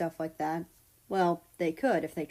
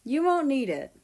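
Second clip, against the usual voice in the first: calling back; 6 semitones higher, 4 dB louder than usual. A narrator's reading. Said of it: "Russia called back".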